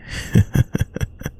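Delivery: a little laugh at his shyness; hushed giggle. Acted. Teasing the shy listener.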